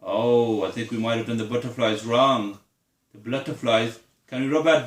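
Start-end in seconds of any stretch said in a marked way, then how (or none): none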